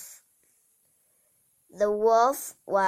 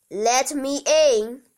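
big bad wolf; 4 semitones higher, 5 dB louder than usual. Wolf demands entry third time.

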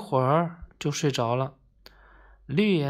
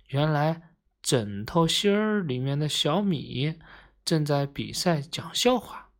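plain reading voice

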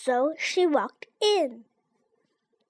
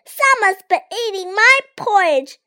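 higher, louder little bear cry; high, loud baby bear wail; wee Baby Bear; 6 semitones higher, 6 dB louder than usual. A narrator's reading. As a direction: cry out like a tiny upset cub. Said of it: "cried wee Baby Bear".

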